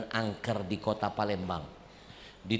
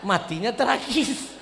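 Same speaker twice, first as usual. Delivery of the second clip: panic; 8 semitones higher, 5 dB louder than usual. Retold or imitated. Panicked recollection at the grave.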